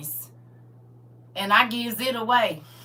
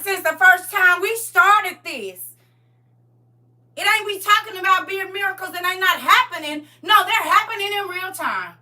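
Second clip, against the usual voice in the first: fired-up testimony; 8 semitones higher, 9 dB louder than usual. Real, not acted. Testifying about miracles.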